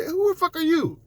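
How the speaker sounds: high, strained outraged squeal; incredulous mock voice. Comedian voices his outrage at the Karen, high mock squeal. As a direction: pitch way up in disbelief. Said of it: "I'm like".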